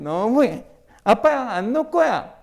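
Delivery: mock sulky protest to dad; upset daughter. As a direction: imitate a daughter lightly throwing a fit. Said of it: "갑자기 우리 딸이 막 이렇게 하거든". (아빠에게 토라진 딸 흉내)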